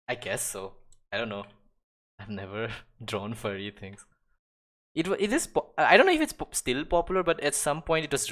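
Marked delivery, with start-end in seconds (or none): 5.0-8.3 high and loud, tumbling out; suddenly animated and louder; excited. Real, not acted. Excited about furry art.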